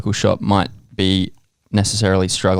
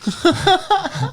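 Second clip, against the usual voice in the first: high-pitched laugh. Real, not acted. Laughing at own joke.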